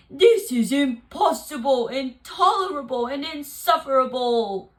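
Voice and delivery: loud, furious bear; shaking with anger and disbelief; angry outcry. Acted. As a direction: bear outraged at the mouse. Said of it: "cried the bear".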